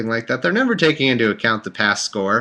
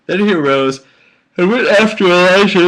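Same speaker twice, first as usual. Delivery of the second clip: loud, high put-on voice, building; hammed-up Bible proclamation; mock-dramatic reading; 9 semitones higher, 6 dB louder than usual. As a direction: read scripture in an overblown, rising cry. Mock-dramatic scripture reading, then apologizes.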